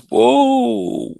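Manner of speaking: high-pitched startled whoa. Retold or imitated. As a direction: jolt like a rabbit in headlights. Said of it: "Rabbit in headlights".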